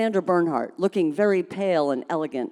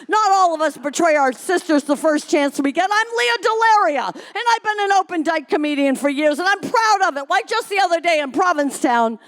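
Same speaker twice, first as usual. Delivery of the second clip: loud rant; 11 semitones higher, 4 dB louder than usual. Acted. Leah Delaria ranting at Reno.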